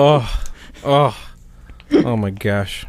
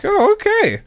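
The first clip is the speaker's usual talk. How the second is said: high, loud mock-nervous reply; nervous acceptance, imitated; 5 dB louder than usual. Imitating shy Eric being introduced to everyone, nervous. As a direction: imitate an awkward okay, pitched way up. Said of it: "really nervous kind of smile".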